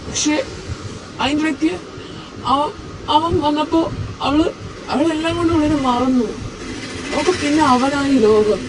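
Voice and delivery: high, quiet voice close to tears; tearful. Acted. Husband tearfully telling a friend how his wife left. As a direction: let it crack as you confess, near crying. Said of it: "നീ കരയാണോ?".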